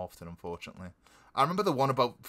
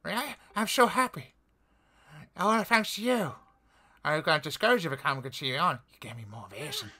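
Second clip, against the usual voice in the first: put-on higher, eager young villager; character voice; 5 semitones higher than usual. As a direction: gush with happy thanks. Voicing NPC dialogue.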